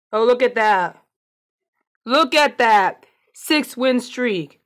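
slow, drawn-out triumphant boast; excited gloating. Real, not acted. Player shows off a win streak to viewers, excited gloating.

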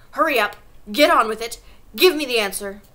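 loud, insistent orders; impatient demand. Acted. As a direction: badger the pupil for an answer. Headmistress badgers a flustered pupil.

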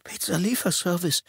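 put-on high, hoarse young voice; teenage delivery boy rasping his job. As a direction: croak it out, scared stiff. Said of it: "sagte er heiser".

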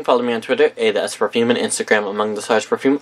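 ordinary talk

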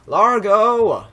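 high, loud, drawn-out cry; emphatic exclamation. Real, not acted. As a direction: proclaim the word loudly, stretching it. Corrects himself, exclaims the name.

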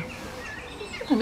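ordinary talk

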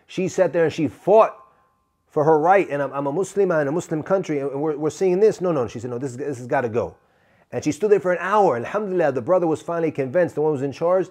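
ordinary talk, nothing marked

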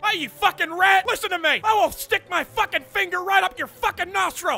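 barking drill sergeant berating a recruit; angry drill sergeant. Acted. Drill sergeant chewing out a player in the lobby. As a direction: play the foul-mouthed sergeant.

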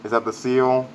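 ordinary talk

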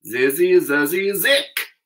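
slow, high chanted spell; magic incantation. Acted. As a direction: intone it like a spell. Reciting the magic charm.

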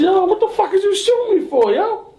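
very high-pitched, incredulous outcry; startled, high-voiced demand; alarmed retold protest. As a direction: challenge the shooter in high panic. Confronting the man shooting him.

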